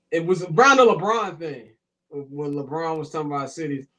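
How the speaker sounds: usual speaking voice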